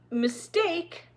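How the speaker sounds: high, incredulous angry question; outraged echo; scornful disbelief. A narrator's reading. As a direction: throw the word back in scorn. Nye scoffs at the apology.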